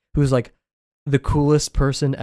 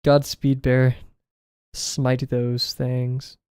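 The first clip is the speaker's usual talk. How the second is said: very slow grand decree; dramatic slow command; much slower than usual. Real, not acted. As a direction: drag each word out grandly. Dramatic send-off to a friend.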